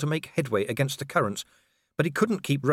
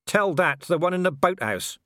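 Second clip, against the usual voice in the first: high, raised-voice comeback; shouted retort; 8 semitones higher, 4 dB louder than usual. Acted. Vimes answers the werewolf from the boat, shouted retort. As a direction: call it back higher than your normal voice. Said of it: "Vimes shouted as the current took the boat".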